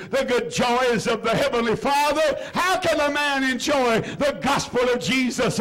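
rising, pleading prayer to God; fervent prayer. Acted. Preacher praying aloud for the lost, fervent and rising. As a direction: plead with the Father in earnest.

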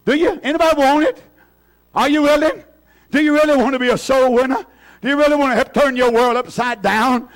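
high, urgent pulpit questions; impassioned preaching challenge. Real, not acted. Preacher asking who really wants revival, impassioned challenge. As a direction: press the congregation, pitched high and forceful.

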